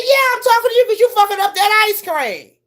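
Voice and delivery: high, mocking imitation of the guy; teasing man. Mimicking the stranger teasing her about the ice cream, high and mocking. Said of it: "He said".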